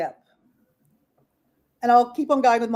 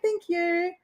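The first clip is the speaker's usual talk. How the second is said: lightly cooed, higher pet voice; baby talk; 7 semitones higher, 5 dB quieter than usual. Retold or imitated. Thanking her chicken for the egg in light baby talk. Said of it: "make those baby noises that you do".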